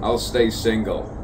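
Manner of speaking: usual speaking voice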